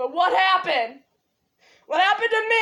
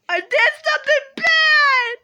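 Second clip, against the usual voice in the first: mock crying; 6 semitones higher than usual. Repeated with crying, pitch up.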